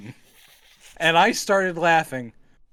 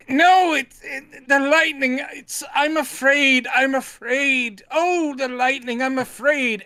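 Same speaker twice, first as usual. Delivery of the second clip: high, slow hammed-up fear; mock terrified actor; 7 semitones higher than usual. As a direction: overplay a ham actor's fright. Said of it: "he just keeps going".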